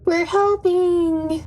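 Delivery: drawn-out high sing-song. Pretend. Player chiming in after a poor roll, sing-song. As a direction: stretch it high and slow, singing it out. Sing-song boast amid weak dice rolls.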